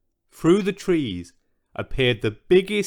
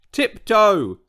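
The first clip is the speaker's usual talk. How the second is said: louder, higher shouted command; bellowed order; 7 semitones higher, 5 dB louder than usual. Acted. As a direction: bellow it at the noisy giant. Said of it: "George would bellow".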